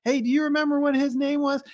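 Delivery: high, eager question to the bystanders; calling out to the people nearby; excited call-out. Retold or imitated. Gate agent asking bystanders for the name, high and eager. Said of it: "she asked everyone".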